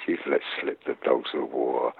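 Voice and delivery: very low, quiet Shakespeare line; soft acting. Actor as Antony looking at his slain friend, soft and low. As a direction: say it softly to the dead man. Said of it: "And I've done it softly".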